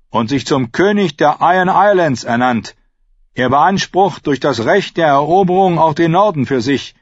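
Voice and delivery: loud, high-pitched lordly declaration; self-important prince making a speech; proud Theon proclaiming. Acted. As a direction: proclaim your claim to a crowded hall. Theon addresses the hall.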